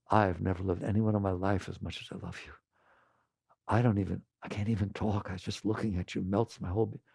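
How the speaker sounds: soft, low, hushed love talk; tender confession. Pretend. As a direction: whisper your devotion, breathless. Someone confessing love to you.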